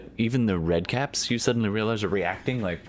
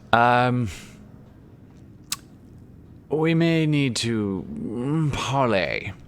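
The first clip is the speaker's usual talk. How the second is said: slow, raised call to the party, hesitant; calling out a truce; 6 semitones higher, much slower than usual. Acted. Archibald calls to the party, slow and raised. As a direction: draw the word out, calling to allies.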